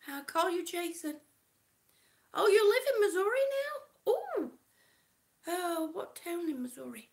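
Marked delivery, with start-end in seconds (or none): 2.3-4.5 loud, fast, high surprised exclamation. Real, not acted. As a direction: react with delighted surprise. Surprised reacting to viewer.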